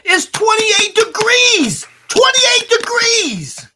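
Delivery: drawn-out, high-pitched shout; loud exclamation. Real, not acted. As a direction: bellow it slowly, stretching every word. Exclaiming over the temperature outside.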